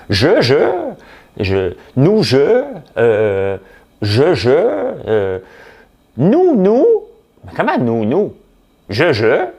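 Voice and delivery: hammed-up stutter, high voice; mock stammering politician. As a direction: flounder for words like a cornered official.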